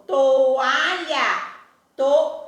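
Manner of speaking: "toalha" slowly stretched, to-A-lha, with a long strong "a"; stress on A. Shown as a demonstration. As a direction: sound out "toalha", then "toa" stopping on a loud, held "a". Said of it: "o A é a sílaba mais forte". ("toalha" bem devagar, esticando o "a" forte)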